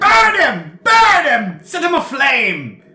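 high, very loud battle yell; shrieked command at full volume; frenzied shout. Acted. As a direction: scream it like rousing a mob to attack. Bird heard yelling from behind.

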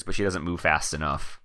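low, soft, ominous narration; understated ominous note; unintentionally ominous. Real, not acted. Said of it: "They just said it sounded very ominous".

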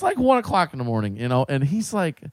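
ordinary talk, nothing marked

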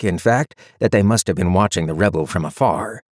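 plain reading voice

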